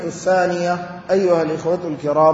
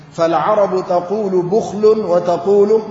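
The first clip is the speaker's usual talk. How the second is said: ordinary talk, nothing marked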